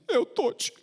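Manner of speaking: put-on high little boy's reply; child voice. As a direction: answer like a small hurting child. Child answers the doctor. (voz de criança)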